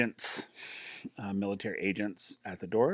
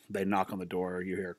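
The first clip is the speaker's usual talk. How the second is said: ordinary talk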